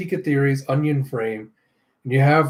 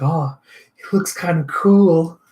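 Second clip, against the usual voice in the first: put-on dreamy girl voice; 3 semitones higher than usual. Nola admiring Chaos.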